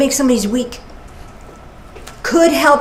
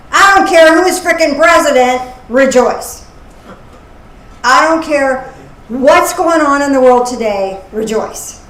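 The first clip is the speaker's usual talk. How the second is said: louder, fired-up sermon emphasis; preaching; 2 semitones higher, 6 dB louder than usual. Acted. Speaker paraphrasing Philippians to listeners, forceful preaching. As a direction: preach it with full conviction.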